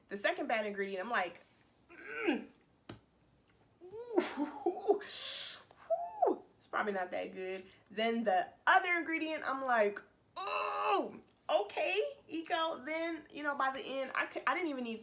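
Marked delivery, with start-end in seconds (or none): 2.3-7.6 higher hooted oohs, then dropping to doubt; wincing alarm. Retold or imitated. Stronger acted reaction to second ingredient. 10.3-12.8 high, drawn-out affronted reaction; mock dismay. Acted shocked reaction to worst ingredient.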